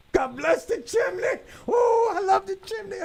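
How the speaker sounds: high, slow, gushing praise, hammed-up; mock fervent prayer. Host acting out grace at his dinner table, mock fervent prayer. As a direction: pray to the chimney like a devout believer, overdone.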